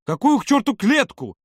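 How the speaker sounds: high, drawn-out indignant cry; almost yell in disbelief; nearly shouting. A narrator's reading. Володя rejecting the cage accusation, nearly shouting. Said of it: "почти прокричал он".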